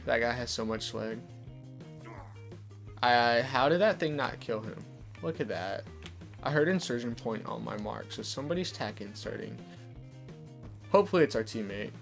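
ordinary talk, nothing marked

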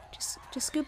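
hushed coaxing; whispering to a monster posing for a photo. Real, not acted. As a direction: whisper so it won't move. Coaxing a mob for a picture.

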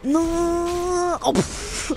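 high groan of dismay; exasperated cry at dying. Real, not acted. Dies in game.

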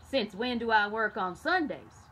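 the mule objects, loudly; talking mule protesting; mule's question. A narrator's reading. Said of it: "asked the mule".

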